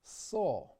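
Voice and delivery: usual speaking voice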